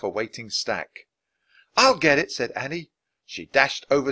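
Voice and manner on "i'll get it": higher eager offer; eager volunteer. Acted. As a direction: dart off eagerly. Annie dashes for book.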